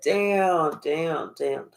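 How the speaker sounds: dropping into a low murmur of disbelief; stunned disbelief. Real, not acted. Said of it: "I said".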